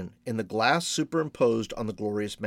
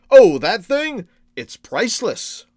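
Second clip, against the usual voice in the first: put-on high, slow, airy old merchant; playful old shopkeeper savouring his answer; genial old shopkeeper; 8 semitones higher, 8 dB louder, slower than usual. A narrator's reading. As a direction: open loud and high, drawing the words out. Shopkeeper answers about the mask.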